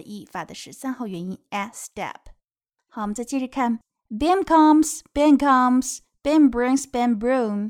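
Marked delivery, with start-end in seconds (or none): 4.1-7.7 loud bright storybook reading; reading to a toddler. Acted. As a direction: announce it to a small child. Reads the book aloud to kids.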